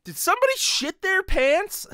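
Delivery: sudden high, loud outburst from the crowd; mock heckler yelling mid-scene; imagined blurt. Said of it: "just blurts out".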